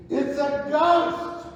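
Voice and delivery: high, loud and drawn-out terrified cry; fearful cry. Retold or imitated. Disciples on the sea crying out in fear at a figure. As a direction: cry out like sailors seeing a ghost. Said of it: "the twelve cry out in their fearfulness".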